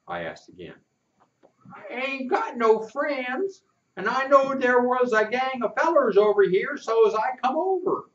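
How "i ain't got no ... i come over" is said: put-on whimpering boy. Lonely boy explains why he came, mildly whimpering. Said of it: "he whimpered".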